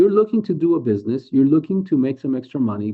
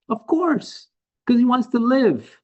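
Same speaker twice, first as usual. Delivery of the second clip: emphatic exclaim; 11 semitones higher, 3 dB louder, slower than usual. Real, not acted. Answering his own question emphatically.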